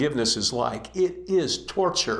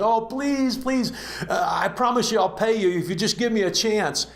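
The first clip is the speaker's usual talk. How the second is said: high, desperate begging voice; pleading debtor; 7 semitones higher than usual. Acted. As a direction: beg the king for mercy, pitched way up. Debtor begs the king.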